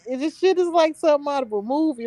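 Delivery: usual speaking voice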